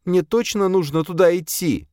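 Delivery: put-on high, small frightened girl; timid girl voice. A narrator's reading. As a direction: voice her high and unsure. Frightened girl asks master.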